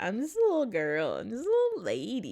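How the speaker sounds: loud, cutesy little-lady cat voice; Kaya. Retold or imitated. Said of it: "just so tiny".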